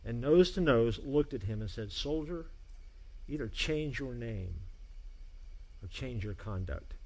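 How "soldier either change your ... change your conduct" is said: quiet threat. A narrator's reading. Nose to nose threat.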